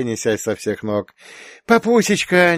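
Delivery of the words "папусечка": put-on high, eager child's cry; a little one calling her daddy; excited little girl. A narrator's reading. Second daughter greets her dad, high and eager. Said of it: "не отставала Юля".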